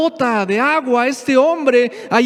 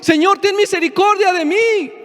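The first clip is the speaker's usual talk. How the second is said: raised, pitched-up plea; pleading cry; 6 semitones higher than usual. Retold or imitated. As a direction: beg God, lifting the voice. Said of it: "te postras delante de Dios y dices".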